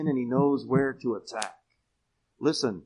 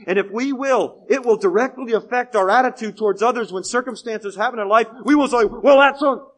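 loud, higher-pitched sermon proclamation; forceful preaching; 7 semitones higher, 10 dB louder than usual. Real, not acted. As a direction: proclaim it to the whole congregation.

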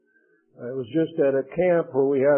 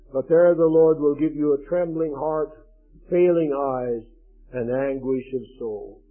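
solemn, emphatic Bible reading; solemn scripture reading to a congregation; 4 dB louder than usual. Acted. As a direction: proclaim the warning like a preacher. Reads Deuteronomy curse passage.

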